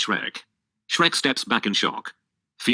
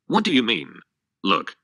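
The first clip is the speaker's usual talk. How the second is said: ordinary delivery, nothing marked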